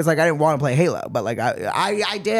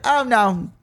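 hammed-up posh French-ish accent, higher; mock French voice; 5 semitones higher than usual. Mocking the French explaining spelling, posh mock accent. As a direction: imitate the French as a snooty accent, darling. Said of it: "when do the French have a British accent?".

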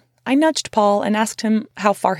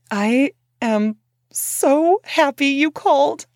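slow and higher, on the edge of tears; near tears; 6 semitones higher, slower than usual. Retold or imitated. To Big Frank on the phone, near tears of relief. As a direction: let relief almost break into crying. I almost started crying.

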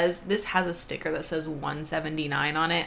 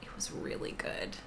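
whispering; 10 dB quieter than usual. Acted. Whispered review of the film.

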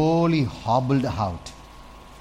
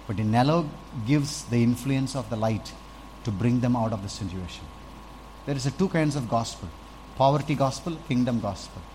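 normal talking voice

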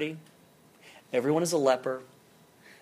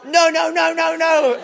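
higher, louder wailing no; child in denial; 10 semitones higher, 8 dB louder than usual. Retold or imitated. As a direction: protest like a kid refusing the news.

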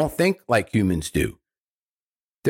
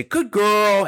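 praise for puppy; 7 semitones higher than usual. Shown as a demonstration. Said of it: "and then you say".

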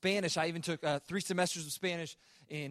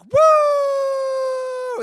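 put-on much higher, much louder "Woo!" like a thrilled girl; ecstatic child shout; 17 dB louder than usual. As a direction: squeal it with total joy. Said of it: "and is like".

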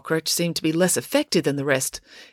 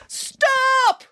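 very high-pitched call to halt; urgent cry; 3 dB louder than usual. A narrator's reading. April interrupts the whole crowd, high urgent cry. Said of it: "cried April".

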